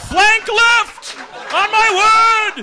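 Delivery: very high, loud, slow shouted commands; shouting orders over battle. Acted. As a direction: bark orders across the battlefield.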